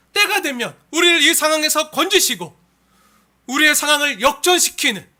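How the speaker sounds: loud, high, driving sermon climax; full-force preaching. Real, not acted. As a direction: proclaim it forcefully to the congregation. (힘차게 외치는 설교)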